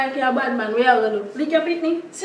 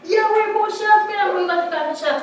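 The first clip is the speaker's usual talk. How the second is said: alarmed shout; 8 semitones higher, 5 dB louder than usual. Acted.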